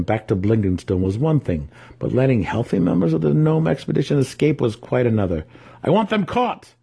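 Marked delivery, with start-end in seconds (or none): 5.8-6.7 high, furious order; angry demand. Acted. Dinan demanding the fleeing gnomes be caught, angry high order. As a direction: snap out the command.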